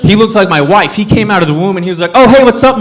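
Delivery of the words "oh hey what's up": lightly voiced baby, loud and higher; cheeky imitated greeting, pitched up; talking newborn. Newborn greeting his mom, higher and louder mock-casual voice.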